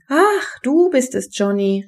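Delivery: high "Ach" falling back to normal; surprised exclamation. A narrator's reading. Mother recognises Johnny on the phone, exclaiming in surprise. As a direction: exclaim high, then settle onto the name. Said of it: "rief Bennys Mutter".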